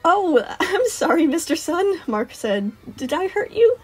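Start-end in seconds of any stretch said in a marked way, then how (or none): 0.0-2.0 put-on high, slow little kid's apology; sheepish kid, pitched up; apologetic child. Child apologizes after hurting Sun. 3.0-3.8 put-on high little kid checking on a grown-up; worried kid, pitched up; concerned child. Child asks the hurt adult.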